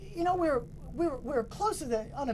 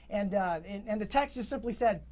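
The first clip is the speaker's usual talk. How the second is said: normal talking voice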